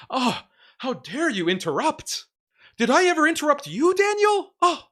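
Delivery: high, shrill-edged mock of a pompous opponent, rising into a shout; sneering parody of an indignant debater; mocking fake accent. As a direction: ham up his fake offended accent. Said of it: "with that fake accent that he tries to pull off".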